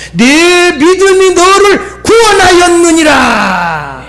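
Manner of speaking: high, slow, ringing declaration; impassioned preacher's cry; full-force proclamation. Retold or imitated. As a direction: proclaim it to the congregation, stretching the words. Same words proclaimed to congregation.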